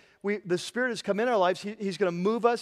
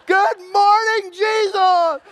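much higher, shouted, hammed-up morning cheer; mock overexcited believer; 13 semitones higher, 10 dB louder, slower than usual. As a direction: ham up a giddy wake-up whoop. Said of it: "I'm not saying that every day you wake up".

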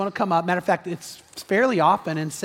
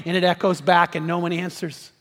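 ordinary delivery

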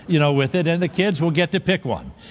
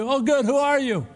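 relieved climber; 9 semitones higher than usual. Retold or imitated. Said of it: "climber answers the voice".

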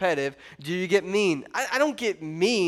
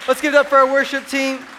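much louder, higher call to praise; loud amen; 4 semitones higher, 7 dB louder than usual. Real, not acted. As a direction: rouse the crowd after the prayer. Amen, calling out to crowd.

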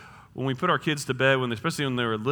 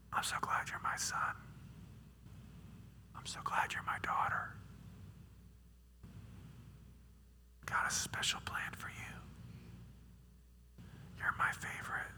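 serious tender whisper; 17 dB quieter than usual. Retold or imitated. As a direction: whisper it close to a child's ear. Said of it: "make it more serious".

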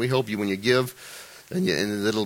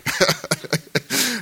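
high, continuing chuckles; real laugh; 10 semitones higher than usual. Real, not acted. Preacher apologising to Mets fans, laughing. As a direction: keep laughing through the apology. Laughs on after saying sorry.

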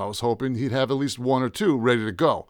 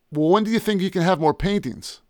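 impatient; 8 semitones higher than usual. Acted. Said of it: "I asked him impatiently".

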